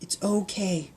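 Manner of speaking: calm the pig gently; soft girl soothing; sighed softly. Acted. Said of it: "Pancake sighed".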